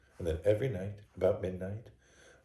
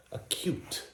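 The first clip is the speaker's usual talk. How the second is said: breathy whispered emphasis on one word; hushed intensity; 11 semitones higher than usual. A narrator's reading. Madman boasting of his sharp hearing, hushed whisper. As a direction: let the word drop to a whisper.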